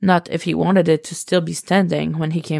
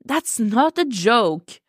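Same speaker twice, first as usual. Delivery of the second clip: higher, slow and incredulous; 6 semitones higher, slower than usual. Acted. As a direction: drag it out in disbelief. Cassie shocked.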